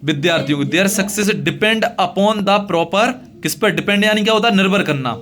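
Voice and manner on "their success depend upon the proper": very slow, each word set apart; slow dictation. Shown as a demonstration. Teacher reading the letter line to students, very slow. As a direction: dictate it so they can copy it. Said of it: "teacher dictating letter slowly".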